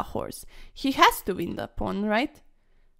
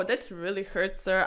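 usual speaking voice

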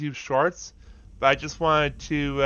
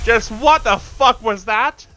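loud, slowed disbelieving curse; real startled reaction; stunned outburst; 8 semitones higher, 8 dB louder, slower than usual. Real, not acted. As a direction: drag it out in shock. Shocked after a boss appears.

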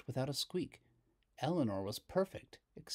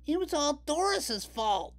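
put-on high, louder scared kids' yell; much higher, louder yell; 6 dB louder than usual. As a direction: boys blaming Doris. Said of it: "they yelled".

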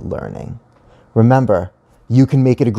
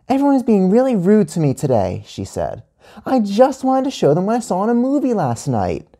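put-on high-pitched aggrieved child; high, put-upon kid voice; child complaining; 11 semitones higher than usual. A narrator's reading. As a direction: complain like a wronged little kid. Gia complains to her teacher.

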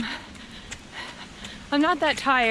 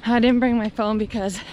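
usual speaking voice

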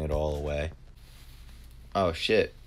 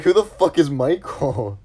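loud, high, drawn-out exclamation; incredulous retold outburst; mock outrage; 10 semitones higher, 10 dB louder, slower than usual. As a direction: exclaim it slowly in hammed-up bafflement. Retells reply to wrong number.

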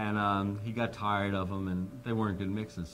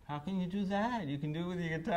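put-on childlike; 9 semitones higher, 4 dB quieter than usual. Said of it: "I felt like a four-year-old".

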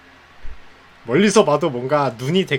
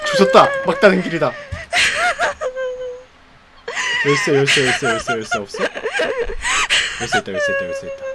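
high frantic shrieks; panicked shrieking; 5 dB louder than usual. Real, not acted. Panic searching key.

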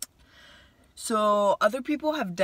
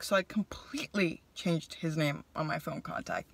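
whispered confession, much softer; whispered aside; 6 dB quieter than usual. Real, not acted. Admitting to viewers he renamed the contact, whispered. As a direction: lean in and whisper it like a secret.

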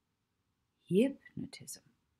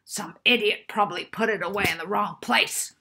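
louder, contemptuous muttering professor; grumbling anger; 3 semitones higher, 8 dB louder than usual. Acted. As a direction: scorn the unknown idiot. Professor keeps ranting.

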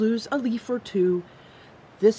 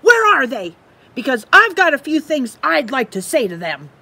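a bit louder, huffy squirrel; indignant squirrel; 5 semitones higher, 8 dB louder than usual. Acted. Squirrel insists to Bird on confronting the thief, indignant. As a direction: puff up, ready to tell them off.

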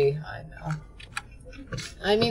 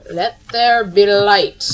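grand proclamation; 12 dB louder, slower than usual. Pretend. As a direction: proclaim it like a deity. Casting light spell grandly.

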